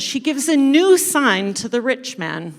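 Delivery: ordinary delivery, nothing marked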